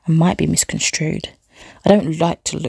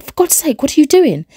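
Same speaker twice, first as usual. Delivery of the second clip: high, louder horrified cry; a desperate alarmed plea; 10 semitones higher, 4 dB louder than usual. A narrator's reading. Husband crying out in horror at his creeping wife. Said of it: "he cried".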